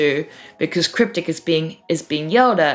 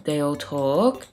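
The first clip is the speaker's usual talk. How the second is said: ordinary delivery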